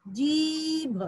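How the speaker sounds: जीभ with a stretched ee, then भ; long vowel. Shown as a demonstration. Teacher showing how the word was read, drawn-out जीभ. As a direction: hold the ee before the भ in जीभ. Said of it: "तो पढ़े थे उसको".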